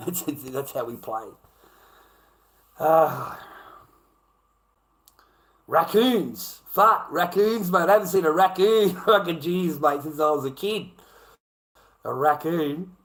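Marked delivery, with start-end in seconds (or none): 2.8-12.9 excited. Real, not acted. Surprised to see raccoons on stream.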